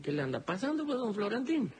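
paisano santiagueño, voz mucho más aguda. Acted. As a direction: saluda cantadito, como viejo de campo. Samaniego pregunta. (Samaniego saluda a su visitante con voz aguda de paisano)

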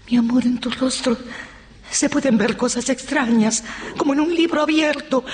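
high, heightened warning to a lover; urgent endearment. Acted. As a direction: warn your love in a high, pressing voice. Warning husband his face betrays him. (advertencia aguda y apremiante)

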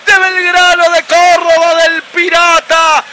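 shouted, high-pitched football goal call with long stretched names. Real, not acted. Radio commentator announcing Belgrano's goal to listeners, full-force goal call. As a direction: belt out the goal and keep the pitch up to the last name.

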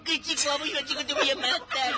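high, indignant mock threat; retold marital outburst. Acts out quarrel with his wife. (estallido de bronca con su señora, actuado)

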